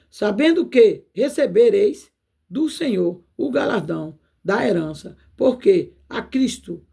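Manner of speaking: slow, solemn Bible reading; measured sermon recital; scripture reading. Acted. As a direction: read the verse slowly, like from the pulpit. Reads scripture verse aloud.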